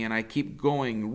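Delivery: plain reading voice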